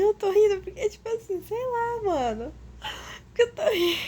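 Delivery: riso choroso, bem mais agudo; rindo e chorando. Real, not acted. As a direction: ri para não chorar. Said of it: "Porque eu tô chorando". (riso embargado de verdade)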